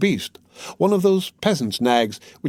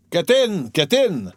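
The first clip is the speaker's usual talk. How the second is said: put-on drunken shout; 5 semitones higher, slower than usual. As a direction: bellow it, pushing everyone aboard. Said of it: "shouted one of them".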